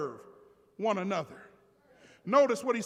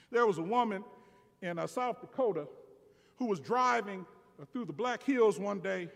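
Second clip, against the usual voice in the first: sermon story narration; 2 semitones lower, 7 dB quieter, faster than usual. Real, not acted. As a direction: tell the anecdote quietly, in an understated voice. Tells an illustrative story in sermon.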